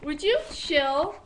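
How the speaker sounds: loud, slow telling-off. Real, not acted. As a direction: tell them to chill. Telling the friend off.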